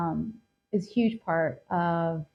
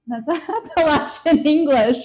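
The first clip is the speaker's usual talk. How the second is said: higher and louder, breaking into a laugh; laughing realization; 8 semitones higher, 8 dB louder than usual. Retold or imitated. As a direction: laugh as it finally clicks. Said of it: "I was like".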